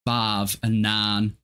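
"barv and nan" said slowly, with broad East Yorkshire vowels for five and nine; drawn-out Hull way of saying five and nine; old Hull accent she trained herself out of; Hull pronunciation. Shown as a demonstration. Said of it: "to not say things like".

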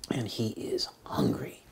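dropped to a near whisper; hushed storytelling. Acted. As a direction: let the hunger land softly. Dramatic beat in the story.

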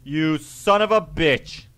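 indignant gamer shout; angry callout. Real, not acted. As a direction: yell at a teammate, then draw out the insult. Calls out friendly fire in game.

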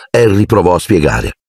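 usual speaking voice

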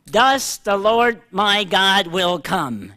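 "Thus" hit hard and held long, the rest slow and weighty; stress on THUS. Shown as a demonstration. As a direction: lean on "Thus", then let the line unfold slowly. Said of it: "Emphasis on the word "thus".

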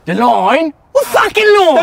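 very high, loud outraged yell; screaming indignant challenge; furious shout. Acted. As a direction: explode in disbelief. Furious quarrel over crossed line.

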